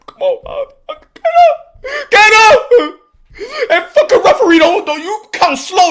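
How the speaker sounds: high, loud cries urging him up; real yelling. Real, not acted. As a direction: scream at the fighter to rise. Yelling at fighter on screen.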